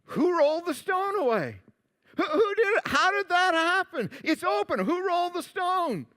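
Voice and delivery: high-pitched, baffled questioning; high, puzzled amazement at a shocking find; astonished women. Acted. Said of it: "in unison they thought, if they didn't say it, they said".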